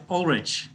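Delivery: "Ulrich" said slowly, ending in CH; soft CH ending. Shown as a demonstration. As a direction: confirm "Ulrich" with a final "tch", as in "rich". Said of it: "can be pronounced either way".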